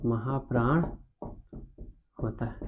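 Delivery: slow, low, soft dictation. Real, not acted. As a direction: stretch each word out as you write it. Dictating slowly while writing it out. (धीमे, दबी आवाज़ में लिखवाते हुए)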